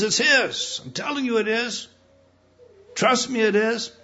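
high, loud preaching; fervent sermon climax; preaching at full force. Acted. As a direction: preach it hard to the congregation. Preacher raises pitch and volume.

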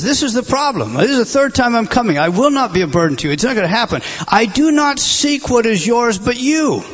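loud, rising preacher's voice; a pastor pressing Paul's plea home; fervent preaching. Acted. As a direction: preach it with full fire. Preaching Paul's words at full force.

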